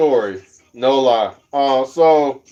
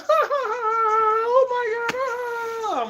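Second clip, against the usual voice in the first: very high, hammed-up laughing squeal; mock professional laugh; much slower than usual. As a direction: imitate someone helpless with laughter, way up high. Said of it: "The professional laugh when they be".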